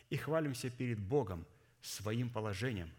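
ordinary talk, nothing marked

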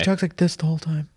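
a light husky put-on, a bit quick; just a hint of the actress's husky voice; imitating a husky voice. Describing the lead actress to a friend, a light husky imitation. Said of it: "she's talking, like, really deep".

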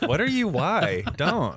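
high-pitched mock bafflement; baffled reaction. People reacting to a weird accent, high baffled protest. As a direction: react as if put off by a silly accent. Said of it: "then people are like".

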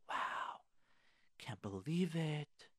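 breathless, slow, stunned disbelief; stunned whisper. Retold or imitated. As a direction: whisper it as if the wind is knocked out of you. Stunned husband hearing wife's complaint.

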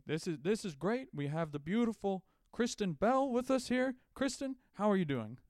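comic De Niro welcoming Kristen Bell; De Niro talk-show host. Pretend. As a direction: greet a celebrity guest like a cheesy host. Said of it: "my character is, it's Robert De Niro interviewing celebs".

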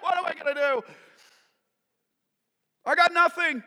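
high, helpless servant's outburst; acted panic. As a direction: throw up the words in panic. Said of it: "He's filled with panic".

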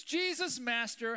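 high, loud cry across a distance; loud call. A narrator's reading. Lepers cry out to Jesus from afar, loud and high. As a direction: call out to him from far away. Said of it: "called out in a loud voice".

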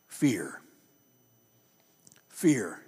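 low, hushed, heavy word fear; a preacher's grave pause on fear; solemn, dropped fear. Acted. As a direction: let fear fall low and quiet, then repeat it. Pastor names the one word.

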